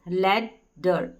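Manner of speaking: ladder split and stretched very slowly; teacher drawing out each syllable of ladder; slow syllable demo. Shown as a demonstration. Teacher breaking up ladder for students, very slow. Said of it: "तो हो गया ladder".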